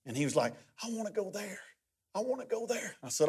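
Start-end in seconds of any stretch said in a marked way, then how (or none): 0.8-2.9 soft, wistful imitation; quiet pleading, like a remembering child; childlike longing. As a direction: voice a sick friend's hushed wish. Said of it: "remembering as a child".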